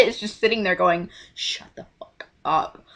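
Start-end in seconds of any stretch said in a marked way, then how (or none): 1.3-2.8 low, slow, hushed mock threat; so mad. Sister silencing her embarrassing sibling, mad. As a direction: imitate seething under your breath. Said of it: "She was just so mad".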